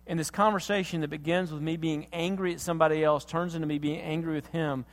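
normal talking voice

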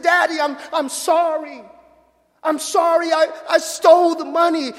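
high, loud, pleading apology through tears; tearful confession. Retold or imitated. Son confessing to his father, loud tearful plea. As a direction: cry out sorry like a guilty boy. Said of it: "the son just burst out in tears".

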